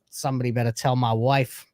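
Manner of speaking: ordinary talk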